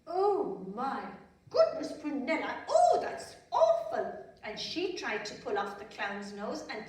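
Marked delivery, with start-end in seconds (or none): 0.1-4.1 high, loud gasping dismay; a queen mother gasping high and loud in alarm; shocked queen. A narrator's reading. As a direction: gasp and cry out in horror. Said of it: "her mother looked and said".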